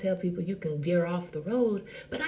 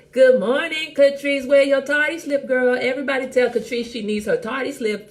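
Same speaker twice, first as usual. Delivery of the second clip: teasing call-out; 5 semitones higher, 7 dB louder than usual. Real, not acted. Teasing latecomer loudly.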